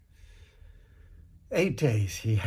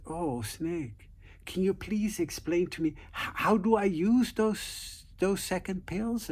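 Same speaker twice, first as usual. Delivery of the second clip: a hint of a falsely sweet wife, pitched higher; sweetly innocent wife; 8 semitones higher than usual. Wife feigns innocence to snake.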